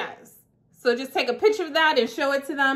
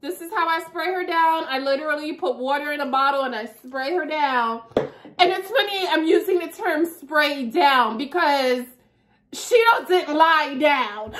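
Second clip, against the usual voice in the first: ordinary talk, nothing marked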